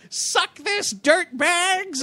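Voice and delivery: high-pitched action-hero taunt; mock defiant taunt. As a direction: play a granny taunting as she fires. Acting granny firing revolver at car.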